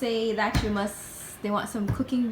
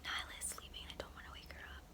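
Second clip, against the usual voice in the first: whispering; 17 dB quieter than usual. Real, not acted. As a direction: hush it, whisper every word. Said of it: "I'm currently whispering because".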